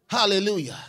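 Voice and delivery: normal talking voice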